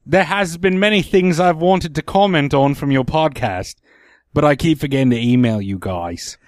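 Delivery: bad English accent that sounds Australian; amateur English accent slipping into Aussie; attempted English accent. Shown as a demonstration. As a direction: read aloud in a wobbly put-on English accent. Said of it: "that sounds more Australian".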